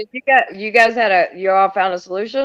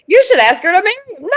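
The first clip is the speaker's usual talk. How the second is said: mock-excited quote; 14 semitones higher, 9 dB louder than usual. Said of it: "You're like".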